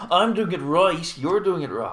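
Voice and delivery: jokey self-righteous protest; mock indignation. Acted retort about his accent.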